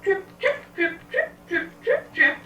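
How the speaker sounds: very high, squeaky little hoofbeats; tiny trip-trap steps. Acted. Little goat crosses the bridge, tiny high-pitched steps.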